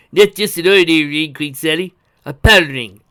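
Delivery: showman-like, loud declaration; grand announcement. A narrator's reading. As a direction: unveil it with a flourish. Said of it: "he said with a flourish of his hands".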